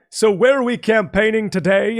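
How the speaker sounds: put-on voice of a politician who doesn't know where he is; clueless politician. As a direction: play a clueless candidate asking his staff.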